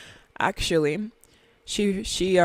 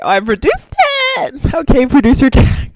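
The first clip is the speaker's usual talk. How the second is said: higher and louder hype call; mock producer tag shout, higher and louder; 7 semitones higher, 7 dB louder than usual. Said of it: "I was like".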